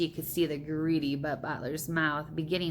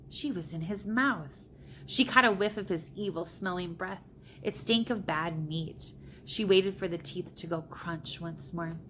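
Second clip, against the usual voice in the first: usual speaking voice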